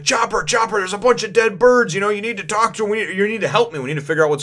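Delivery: Luffy calling Chopper about dead birds, mock panic; frantic calling. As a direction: run in yelling for help. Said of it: "he's like".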